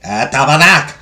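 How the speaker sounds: loud French Canadian curse; French Canadian accent. Acted. Reader tries French Canadian on request, loud accented curse. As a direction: swear it out like a Québécois. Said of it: "French Canadian accent?".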